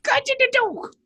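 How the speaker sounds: high put-on British voice; deadpan stage English; mock British. Echoing British bit.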